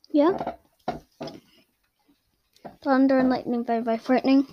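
ordinary delivery

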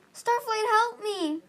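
high, loud, urgent plea; yelling for help. A narrator's reading. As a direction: call out desperately for rescue. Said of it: "Fate Speaker yelled".

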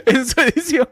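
cracking up mid-sentence; laughing. Real, not acted. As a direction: laugh through the words. Laughing through intro. (riéndose a carcajadas)

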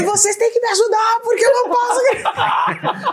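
very high-pitched, laughing mock yell; mock scolding shout. Mom begging the kids for help, comically shouting. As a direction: shriek the plea in a hammed-up strained high voice. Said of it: "gritada aqui".

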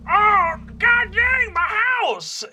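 high, loud, drawn-out wail of dismay; dismayed shout. Acted. Dwarven dad shouting on finding his wrecked house. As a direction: cry out at the ruined house. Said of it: "you hear a voice say".

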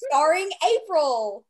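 loud, drawn-out show credit; announcer voice. Acted. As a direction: announce her like a TV star. Echoing mock credit, louder.

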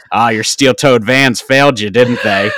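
teasing. Retold or imitated. Buddy teasing, retold.

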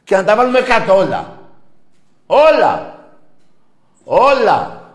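loud, high repeated yell; furious shouted insistence; shouting. Real, not acted. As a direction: hammer out the word twice. Host yelling repeated word.

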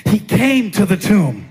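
high, driving sermon delivery; full-force preaching. Real, not acted. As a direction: preach it with building intensity, up into a shout.

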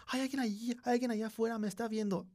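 little brother scared of someone at the window; frightened child. Retold or imitated. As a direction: play the terrified kid pointing outside.

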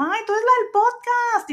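put-on high, loud gushing girl voice; excited fan. A young fan recognizing the podcaster, excited high squeal. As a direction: squeal it like a fan who just spotted her. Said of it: "una chavita de ahí me reconoció y me dijo".

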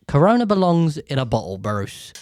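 ordinary talk, nothing marked

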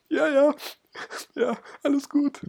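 very high, cracking mock reassurance; mock voice breaking. Boyfriend assures girlfriend all is well, hammed-up breaking voice. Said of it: "du hast irgendwie eine leicht gebrochene Stimme".

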